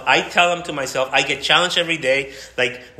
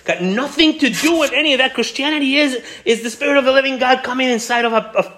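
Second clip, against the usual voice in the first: high, loud ringing proclamation of the Spirit; preaching climax; 10 semitones higher, 5 dB louder than usual. Acted. Preacher crying out to the church what Christianity is. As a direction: lift it up and shout the good news.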